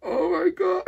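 high squeal breaking into laughter; overcome with joy. Real, not acted. Gamer gushing over the favorite Zico card, overcome with joy. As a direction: squeal in real delight.

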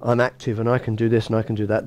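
usual speaking voice

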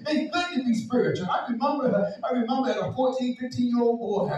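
loud, high, charged preaching; fiery preaching. Acted. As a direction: preach it at full force. Preaching at full force.

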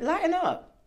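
high mock-chiding sing-song; cards' advice. Cards teasing the hard workers, high playful scold. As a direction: tease them into relaxing. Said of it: "But now they're saying".

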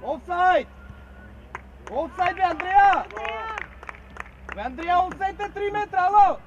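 loud yell from the sideline; real, forceful complaint at full voice; shouted protest. Real, not acted. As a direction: shout the offside call in protest. Coach protesting an offside.

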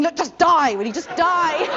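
high, loud mock desperation; exasperated outburst. Actress thinking during an endless improvisation, loud exasperation. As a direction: plead for it to end, voice shooting up. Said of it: "I'm just thinking".

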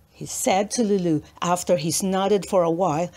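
ordinary delivery

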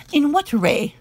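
a lord quizzing his squire, quick and sharp; quick question. A narrator's reading. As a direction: snap the question back. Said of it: "said Marmion, quick".

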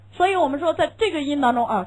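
ordinary delivery

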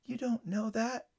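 put-on high, soft, slow child's voice; exhausted little girl. A narrator's reading. Drained girl doubts the paramedic's comfort, exhausted small voice. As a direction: let the words out weakly and slowly. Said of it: "said the exhausted little girl".

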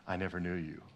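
low, hushed and solemn; very quiet, low pronouncement; grave rejection. Acted. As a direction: quote Jesus softly, with finality. Voices Jesus turning people away.

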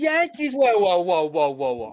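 excited. Real, not acted. Reacting to trade news.

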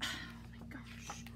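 sighing whisper of embarrassment; exasperated whisper. Real, not acted. Reader embarrassed by the book, whispered sigh. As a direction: mutter it to yourself.

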